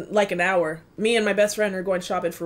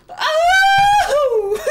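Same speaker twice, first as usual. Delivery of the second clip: laughing cry; 8 dB louder than usual. Real, not acted. Overwhelmed squeal at a lyric.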